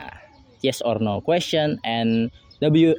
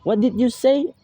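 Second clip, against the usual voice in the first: much higher pitch, rising on "say"; doubting question, "say" lifted high; rising intonation; 12 semitones higher than usual. Shown as a demonstration. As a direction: sweep up high to "say". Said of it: "word say in the sentence is given a rise".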